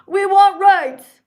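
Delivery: loud high slow protester chant; mock protester. Mocking pride marchers eating hot dogs, loud. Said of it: "people who are like".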